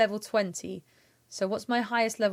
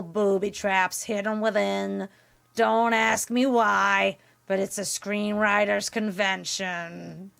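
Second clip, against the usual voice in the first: slow, nasal, crunchy drone; drawn-out cartoon rasp, a bit nasal; Roz; slower than usual. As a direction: narrate in a croaky monster-clerk voice. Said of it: "she's got a bit of nasal as well".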